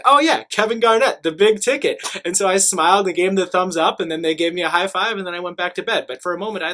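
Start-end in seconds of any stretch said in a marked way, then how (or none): 0.0-2.0 higher and louder, sudden happy recognition; light up with relief as you get it; relieved excitement. Retold or imitated. Relieved traveler recognizes the nickname.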